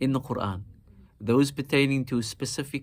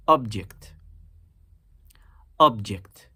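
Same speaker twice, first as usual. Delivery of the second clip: "object" with OB punched loud, then falling; stress on first syllable; 5 dB louder, slower than usual. Shown as a demonstration. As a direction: hit the first syllable of "object" hard, as the noun. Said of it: "We naturally emphasize the first syllable".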